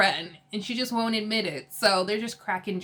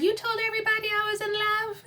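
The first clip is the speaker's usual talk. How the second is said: very high, altered imitation of Teresa; hammed-up shocked sister to her brother; shocked question; 12 semitones higher than usual. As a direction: squeal it up high in mock disbelief. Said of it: "she runs over to Joe and she's like".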